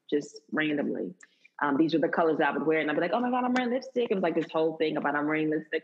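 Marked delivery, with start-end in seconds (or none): 3.1-4.1 higher-pitched, giddy self-quote; mock thrill. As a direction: gush as if amazed at yourself. Voices her thrill at wearing lipstick.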